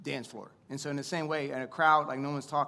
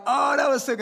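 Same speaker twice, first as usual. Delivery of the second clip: much higher, louder burst of delight; excited reaction; 11 semitones higher, 12 dB louder than usual. Retold or imitated. DJ reacting to the bride's one-word vow, excited. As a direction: shout your amazement at the wedding. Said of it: "And I'm like".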